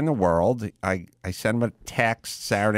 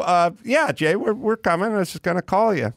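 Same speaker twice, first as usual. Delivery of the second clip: himself, retold; 8 semitones higher than usual. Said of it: "I go".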